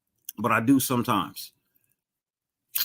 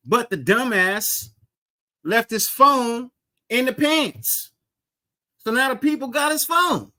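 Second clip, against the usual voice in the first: rising disbelief; incredulous; 7 semitones higher than usual. Real, not acted. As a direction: voice climbs higher and higher. Incredulous story about Antonio Brown.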